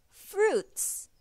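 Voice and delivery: high bright repeat of the word Fruits; high drill. Shown as a demonstration. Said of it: "Let's say that again".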